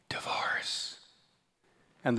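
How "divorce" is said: breathy gossiping whisper. Retold or imitated. Dinner guest whispering gossip to the table. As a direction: hush it like juicy gossip.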